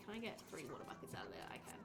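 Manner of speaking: quick hushed muttering; soft whisper to yourself; whispered aside. Real, not acted. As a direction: whisper it under your breath.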